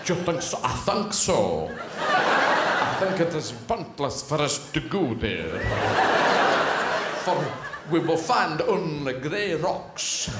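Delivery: put-on slow, shaky-quality borrowed Russian accent. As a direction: do a dubious, drawn-out Russian accent. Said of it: "But with a Russian accent".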